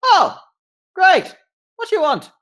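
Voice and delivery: very high, loud, impatient gnome; gnome captain. Acted. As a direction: snap at them as the busy captain.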